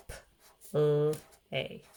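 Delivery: "P, l, a" in separate sounds with pauses; stretched-out sounds. Shown as a demonstration. Teacher asking what sounds you hear, sounded out. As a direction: sound out "P, l, a", holding the l. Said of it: "Stretch that word out".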